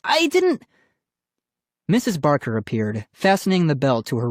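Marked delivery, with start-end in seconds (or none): 0.0-0.6 high-pitched protest; crying out. A narrator's reading. Brother defending himself to family, crying out. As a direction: protest your innocence in a high cry. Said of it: "Ian cried".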